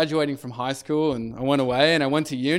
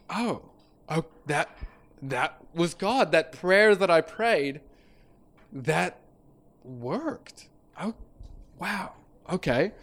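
soft, halting, dawning amazement; stunned realisation; 6 dB quieter, slower than usual. Retold or imitated. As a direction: let it sink in word by word. Stunned realisation in retold dialogue.

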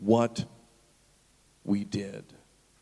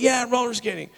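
lightly acted eager cheer, higher pitch; kids' mock enthusiasm; 8 semitones higher than usual. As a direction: imitate excited kids. Kids' excited reply acted out.

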